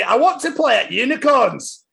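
high Scottish Susie. Acted. Reading Peppa book aloud, high Scots Susie. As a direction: whine it like a wee Scots girl. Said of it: "I'm gonna do it in my accent".

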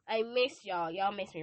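usual speaking voice

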